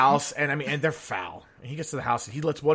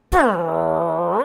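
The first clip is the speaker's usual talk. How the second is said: long, loud lip-buzzed fart; imitated fart noise; 3 semitones higher, 8 dB louder than usual. Dinner guest letting the last one out, fart noise. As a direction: blow a big rasping raspberry. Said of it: "lifts up a little bit and just".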